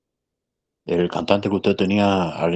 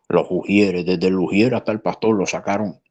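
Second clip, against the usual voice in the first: ordinary talk, nothing marked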